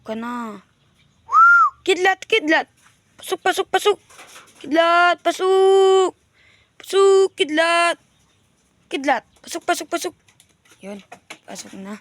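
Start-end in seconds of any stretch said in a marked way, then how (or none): 1.8-10.1 high, loud coaxing call; calling pigeons. Real, not acted. Owner calling his pigeon Kidlat into the coop, high and loud. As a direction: coax the bird home, pitched way up.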